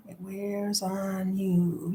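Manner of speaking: heavy, slowed, soft repetition; slow fading softness. Real, not acted. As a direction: let the words drag down and fade.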